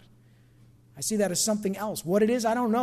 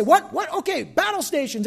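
startled alarm; 7 semitones higher, 5 dB louder than usual. Retold or imitated. Townspeople react to warning.

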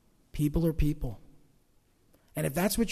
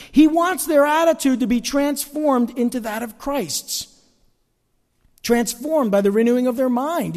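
higher, louder emphatic preaching; 6 semitones higher, 6 dB louder than usual. Acted. Pastor urging the congregation to change, louder. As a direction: drive the point home.

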